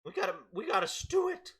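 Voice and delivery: high-pitched scolding shopkeeper; squeaky lecture, quick; high cabbage merchant voice. Acted. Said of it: "says the cabbage man".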